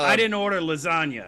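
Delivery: high, loud cranky gnome voice; startled grumpy gnome. Acted. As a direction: squawk at an unexpected visitor, baffled. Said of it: "he looks up and he goes".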